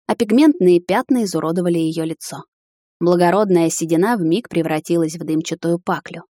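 ordinary delivery, nothing marked

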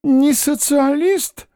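high, slowing, anxious questions; anxious old man. A narrator's reading. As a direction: fuss over the guest, then worry. Old man's anxious hospitality.

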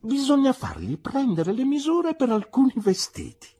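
light up and blurt the answer; brightened answer. Acted. Mister Dick answers the aunt with a sudden bright idea. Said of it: "si illuminò e rispose".

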